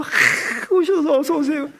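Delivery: high-pitched, eager welcome of guests; welcoming Abraham. Retold or imitated. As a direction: usher honored guests in eagerly, voice lifted. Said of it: "환대해 주는 거야". (반갑게 맞이하는 목소리)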